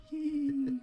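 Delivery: high-pitched mock child giggle; child's ghostly giggle. Podcaster imitating the giggling kid of ghost tours, high eerie giggle. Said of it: "like, giggling in the".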